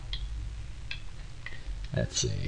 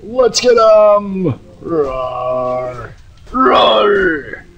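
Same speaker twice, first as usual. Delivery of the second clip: high, slow psycho war cry; Krieg battle roar; 10 semitones higher, much slower than usual. Acted. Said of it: "Krieg is much more of an up-close".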